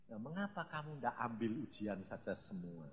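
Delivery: low, soft, easygoing question; relaxed voice of God. Retold or imitated. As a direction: gently suggest it, like an unbothered father. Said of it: "Tuhan tuh ya santai". (suara Tuhan yang santai, rendah dan lembut)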